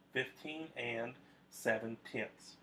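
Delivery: slow, careful teacher demo of "Fifteen and seven tenths"; slow model reading. Shown as a demonstration. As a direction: spell out "Fifteen and seven tenths" slowly for the class. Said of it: "I would say it like this".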